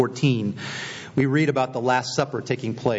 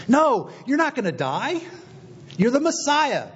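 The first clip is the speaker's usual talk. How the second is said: Peter rebuking; 12 semitones higher than usual. Acted. Peter rebuking Jesus, acted.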